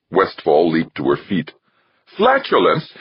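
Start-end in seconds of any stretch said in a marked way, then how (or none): 2.2-2.9 very high, outraged shriek of "Flatulence"; screamed. A narrator's reading. Westfall hearing Archer's word, screaming it back. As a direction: shriek it in appalled disbelief. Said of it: "she screamed".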